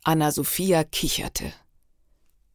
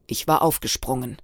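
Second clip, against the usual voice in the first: usual speaking voice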